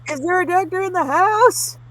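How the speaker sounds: high, loud rising jaguar call; big cat voice. Acted. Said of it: "their big cat voice and ask the room".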